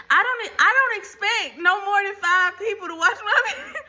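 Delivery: laughing. Real, not acted. Laughing at her own low expectations.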